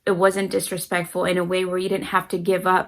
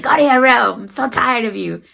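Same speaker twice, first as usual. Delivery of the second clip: loud, higher-pitched mock scolding; screaming parent; 4 semitones higher, 8 dB louder than usual. Parent sending kids to their room, mock scream. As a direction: act a fed-up parent yelling. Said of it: "I'm just gonna scream at my kids".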